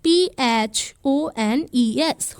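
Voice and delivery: letters spelled out one by one, slowly; slow spelling. Shown as a demonstration. Teacher spells homophones letter by letter, slowly. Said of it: "ऐसे शब्दों को हम बोलते हैं homophones".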